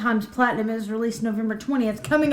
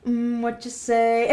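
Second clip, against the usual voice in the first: skit voice; slower than usual. Retold or imitated. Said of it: "reminded me of the skit".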